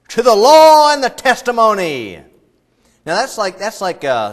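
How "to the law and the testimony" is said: loud, high proclamation; rallying cry. Acted. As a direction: shout it like a battle cry.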